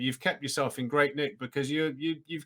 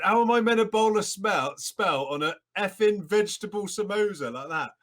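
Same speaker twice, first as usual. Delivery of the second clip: put-on high, loud Welsh accent rant; exasperated Welsh accent, pitched up; Stephen Jones Welsh accent; 6 semitones higher, 3 dB louder than usual. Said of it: "he said in his Welsh accent".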